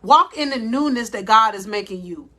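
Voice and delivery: normal talking voice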